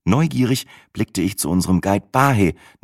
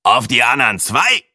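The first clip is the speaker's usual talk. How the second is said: croaking man; 5 semitones higher, 4 dB louder than usual. A narrator's reading. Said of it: "krächzte eine Männerstimme".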